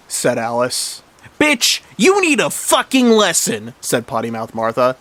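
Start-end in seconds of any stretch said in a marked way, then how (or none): 1.4-3.7 high, slow angry drawl; acted bullying outburst; threatening. A narrator's reading. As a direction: drag out the threat at a high pitch. Martha threatens Alice.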